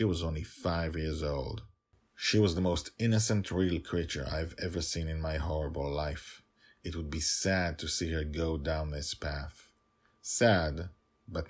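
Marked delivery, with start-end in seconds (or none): none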